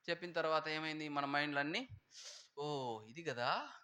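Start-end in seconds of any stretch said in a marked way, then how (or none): 2.6-3.7 slow realization. Retold or imitated. Mind's sudden realization voiced.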